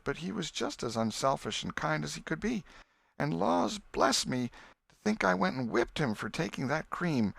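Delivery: a mother mourning her son, sobbing. A narrator's reading. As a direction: break down over the lost son. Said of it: "Missus Harper sobbed".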